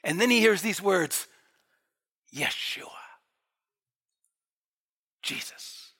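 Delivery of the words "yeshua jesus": low, breathed out, long pause between names; hushed revelation. Acted. Heavenly voice naming itself to Paul, hushed. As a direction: let each name land in near whisper.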